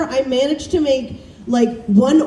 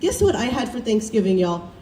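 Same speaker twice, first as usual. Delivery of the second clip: ordinary talk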